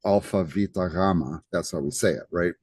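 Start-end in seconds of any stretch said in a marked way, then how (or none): 0.0-1.4 alpha, beta, gamma in Greek, "AL-fa, VEE-ta, GHA-ma"; Greek pronunciation. Shown as a demonstration. Teacher showing how Greeks say the letters, Greek pronunciation. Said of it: "in Greek we would say".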